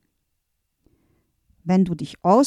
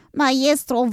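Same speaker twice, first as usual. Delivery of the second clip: Maestro called again higher and more urgent; rising desperate call; 5 semitones higher than usual. Acted. Helper calls the master again, higher and pleading. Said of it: "und rief verzweifelt".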